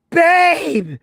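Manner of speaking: loud, high alarmed call. Retold or imitated. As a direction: call her name in a fright. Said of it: "was like".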